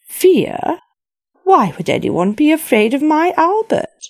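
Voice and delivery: high, loud astonishment; astonished queen. Acted. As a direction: recoil in disbelief.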